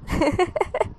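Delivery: light, high amused giggle; short run of soft chuckles; little laugh. A narrator's reading. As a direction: giggle as if tickled by the idea. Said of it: "थोड़ा सा हंसते हुए".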